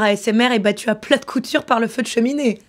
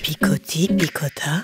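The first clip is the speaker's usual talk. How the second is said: low voice; 6 semitones lower, 3 dB quieter, slower than usual. Acted. Mock ASMR opening whisper tease.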